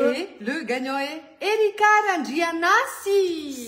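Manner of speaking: loud, slow winner reveal; giveaway announcement. Real, not acted. (annonce du gagnant, forte et lente)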